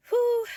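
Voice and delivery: sharp high whew to blow off anger; a loud calming breath out; exasperated whoosh of breath; loud high exhale. Real, not acted. Calming herself mid-rant.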